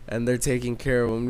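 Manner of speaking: ordinary delivery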